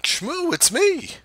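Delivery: high, bright excited hello; eager greeting. Retold or imitated. Narrator greeting the homeless man he gives money to, eager and high. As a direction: greet him like an old buddy.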